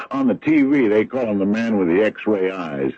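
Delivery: loud, scornful jeer at the TV psychic; mocking loud. Real, not acted. Sheriff scoffing at Decker about the TV psychic, loud and mocking. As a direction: raise your voice and sneer at the idea.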